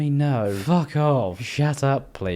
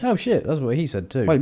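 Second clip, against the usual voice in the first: usual speaking voice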